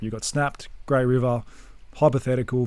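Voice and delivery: usual speaking voice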